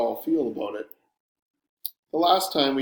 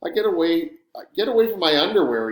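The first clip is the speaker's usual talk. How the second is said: loud, higher, irritated order; snapping; 5 semitones higher, 5 dB louder, faster than usual. A narrator's reading. Boy orders his friend away from his things, snapping. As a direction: snap it out fast and louder. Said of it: "he snaps at me".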